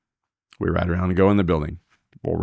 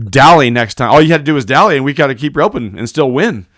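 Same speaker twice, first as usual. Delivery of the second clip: loud, high, insistent lecture; exasperated scolding; 7 semitones higher, 8 dB louder than usual. Retold or imitated. Roper telling partner to dally next time, exasperated and loud. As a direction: drive the point home at raised volume.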